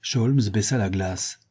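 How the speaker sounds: plain reading voice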